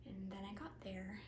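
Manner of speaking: breathless whisper; hushed, scared whisper. Acted. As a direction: drop to a whisper, no voice. Arriving, afraid.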